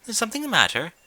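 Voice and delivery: high, refined, worried lady voice; Rarity asks the distracted Rainbow, concerned. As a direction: ask with dainty concern. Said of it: "eyebrows furrowing".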